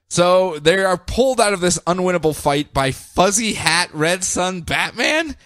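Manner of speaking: ordinary delivery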